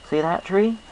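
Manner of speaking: high, bright country drawl for the farm mare; folksy farm pony, friendly and sure; Applejack. Acted. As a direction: play a cheerful country mare with a twang. Applejack's voice in story.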